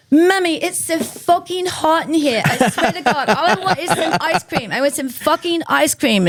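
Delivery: put-on high, whiny toddler demanding ice cream; squeaky little-kid tantrum voice; a toddler complaining to her mom; toddler whining. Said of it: "Kelly Osbourne is just going".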